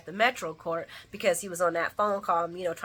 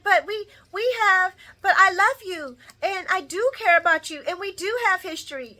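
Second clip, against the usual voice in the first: higher, louder pleading lover; pleading woman; 12 semitones higher, 5 dB louder than usual. Retold or imitated. A woman pleading with the man leaving her. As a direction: beg him not to leave. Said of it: "she's like".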